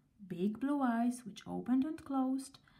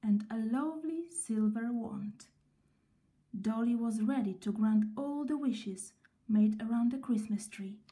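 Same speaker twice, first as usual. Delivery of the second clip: ordinary delivery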